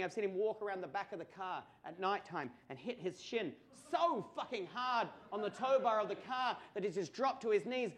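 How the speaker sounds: ordinary talk, nothing marked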